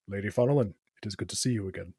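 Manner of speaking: low, soft, formal knight; knight's greeting. Acted. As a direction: greet her with heavy, low courtesy. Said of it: "turns to face you and says".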